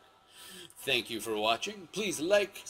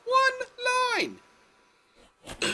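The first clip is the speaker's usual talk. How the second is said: even higher, louder, at the top of the voice; peak exasperated shout; 6 dB louder than usual. Real, not acted. Vlogger's final outburst at the prequels, topmost shout. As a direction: let it explode at full volume.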